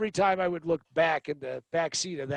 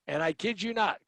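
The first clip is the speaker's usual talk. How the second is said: ordinary delivery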